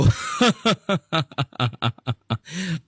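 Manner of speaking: villain's gloating laugh; evil laugh. Acted. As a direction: cackle like a tyrant. (দাম্ভিক খলনায়কের অট্টহাসি)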